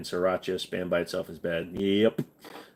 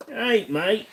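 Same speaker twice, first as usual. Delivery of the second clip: a much higher, sing-song Aussie "All right, mate" rising at the end; "All right, mate" pitched way up in a cheery Australian accent; 12 semitones higher, slower than usual. Acted. Streamer repeats the greeting in an exaggerated high Australian accent. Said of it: "It's a very decent Aussie accent".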